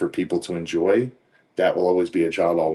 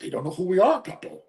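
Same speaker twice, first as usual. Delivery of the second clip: high, cocky imitation of the wrestlers; playful mock reply; 12 semitones higher than usual. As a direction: tease it like it's obvious. Retelling the wrestlers' cheeky answer.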